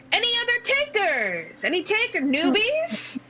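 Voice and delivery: high-pitched, projected gnome announcer; booming little showman welcoming a crowd. Acted. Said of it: "bizarrely booming voice".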